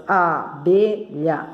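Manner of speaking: ABELHA slowly split as a-be-lha; abelha, slow, with a gap after each syllable. Shown as a demonstration. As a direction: sound out abelha in three separate syllables. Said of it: "tem três sílabas".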